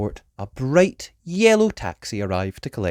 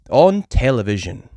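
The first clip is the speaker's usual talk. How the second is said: ordinary delivery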